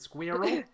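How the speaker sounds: high, loud questioning "Squirrel?" as if to a pet dog. Shown as a demonstration. Showing how she asks her dog "Squirrel?" in a high, loud rising voice. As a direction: ask "Squirrel?" brightly, rising, like teasing a dog. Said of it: "that's how I say it to my dog".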